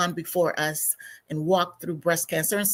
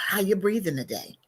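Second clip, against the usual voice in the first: usual speaking voice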